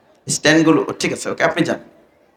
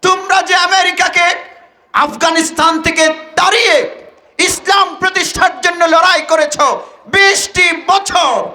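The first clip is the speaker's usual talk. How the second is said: high, fervent declamation of a woman's words; impassioned outburst; 10 semitones higher than usual. Retold or imitated. Woman journalist challenging the Taliban member, exhilarated. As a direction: address them with mounting fervour. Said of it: "উচ্ছ্বসিত হয়ে বলছে".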